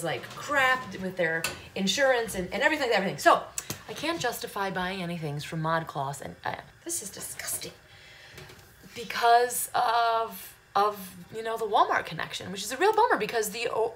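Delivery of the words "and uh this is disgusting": whispered aside, voiceless and hushed. Real, not acted. To the viewers about ModCloth, a hushed whisper. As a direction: drop to a conspiratorial whisper.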